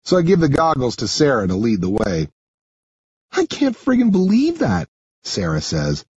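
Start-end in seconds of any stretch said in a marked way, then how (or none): 3.3-4.9 high-pitched, incredulous character voice; high, exasperated complaint; indignant girl. Acted. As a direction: vent in disbelief as the girl. Sarah, indignant, in dialogue.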